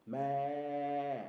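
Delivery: loud bleating meh; goat bleat. Shown as a demonstration. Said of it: "The sound of a goat".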